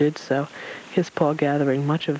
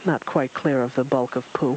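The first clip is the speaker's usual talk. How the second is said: usual speaking voice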